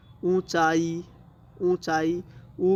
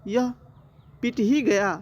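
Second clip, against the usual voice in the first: surprise; 5 semitones higher, 3 dB louder than usual. Shown as a demonstration. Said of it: "अब है विस्मयवाचक".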